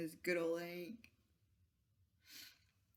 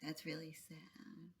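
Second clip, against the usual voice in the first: soft whisper; 3 semitones lower, 10 dB quieter than usual. Real, not acted. Reacting to a sad story.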